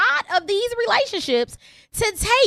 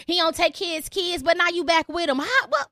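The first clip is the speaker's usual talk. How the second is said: ordinary talk, nothing marked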